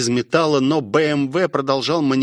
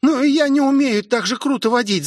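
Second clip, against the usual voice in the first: put-on high, anxious girl's voice; panicking; 11 semitones higher than usual. A narrator's reading. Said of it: "запаниковала девушка".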